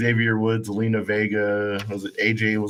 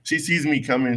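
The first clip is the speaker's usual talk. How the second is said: ordinary talk, nothing marked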